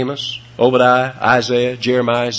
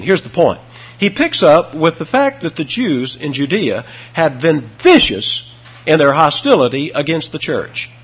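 ordinary talk, nothing marked

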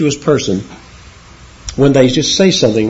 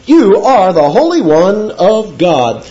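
reverent quote; 6 semitones higher, slower than usual. Acted. Quoting Peter's confession.

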